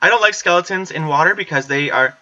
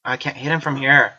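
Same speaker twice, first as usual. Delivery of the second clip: normal talking voice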